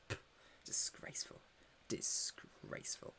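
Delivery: a scandalised stage whisper; softly tut-tutting, playfully outraged; mock disgusted whisper. Mock disgust at French drivers.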